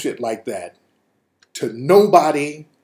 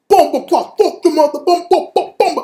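furious Jamaican rant; 11 semitones higher than usual. Retold or imitated. Rick shouting at the American dudes in Jamaican patois. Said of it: "all that Jamaican shit he was doing".

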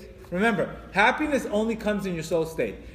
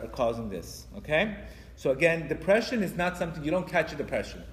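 ordinary talk, nothing marked

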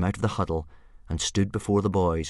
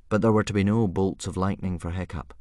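plain reading voice

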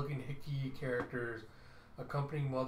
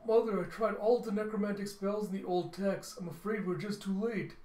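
nervous high report; 7 semitones higher, 4 dB louder than usual. Acted. Son nervously reporting failure.

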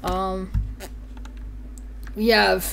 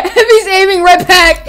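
high, loud laughing exclamation; loud words through a laugh; 10 semitones higher, 12 dB louder than usual. Real, not acted. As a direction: crack up while saying it. Cracking up at the picture.

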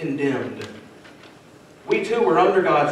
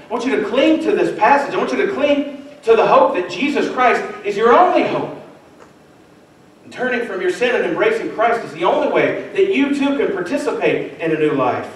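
raised, urgent appeal to the listeners; higher-pitched pulpit appeal; earnest preaching; 6 semitones higher, 3 dB louder than usual. Acted. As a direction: plead with them to cling to Christ. Pleading with the congregation.

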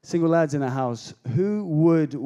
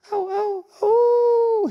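much higher, cry-like swooning oh; lovestruck swoon; much slower than usual. Acted. Jacob spotting Rachel in worship, a high lovestruck swoon. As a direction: swoon like a smitten young man.

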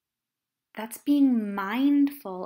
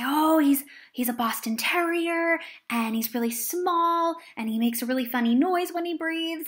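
put-on eager child; 6 semitones higher, 4 dB louder than usual. Child eagerly describing his puppy.

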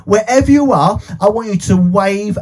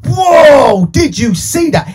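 mock shock; 5 semitones higher, 3 dB louder, slower than usual. After puppet bites nose.

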